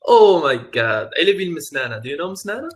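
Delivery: ordinary delivery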